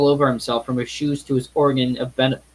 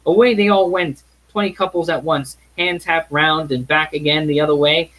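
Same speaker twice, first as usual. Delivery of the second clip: bright, higher, brisker storytelling of the dance; lively narration; 5 semitones higher, 4 dB louder than usual. A narrator's reading. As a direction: sweep the listener into the party.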